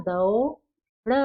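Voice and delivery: दौड़ stretched, द and औ pulled apart; slow syllable demo of दौड़; drawn out slowly. Shown as a demonstration. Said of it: "तो क्या बना".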